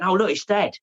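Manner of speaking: put-on an unknowing four-year-old spotting his dad's picture; imitating young son.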